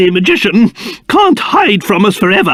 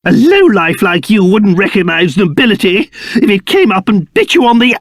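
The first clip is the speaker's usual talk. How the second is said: ordinary talk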